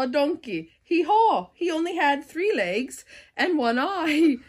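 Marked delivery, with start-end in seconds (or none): none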